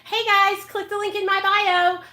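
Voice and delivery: high, chirpy mock YouTuber pitch; mock influencer voice. Mocking cheesy YouTubers to her viewers, high chirpy mock voice. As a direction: parody a cheesy influencer plug. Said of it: "Not saying".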